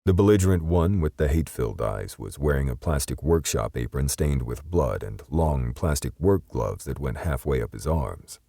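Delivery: plain reading voice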